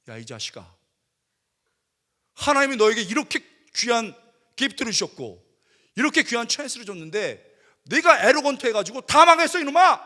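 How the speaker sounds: rising to a loud, high furious scolding; inner furious rebuke. Retold or imitated. Pastor's silent inner rage at the student, furious shout. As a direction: blast the arrogant student with fury. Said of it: "속으로".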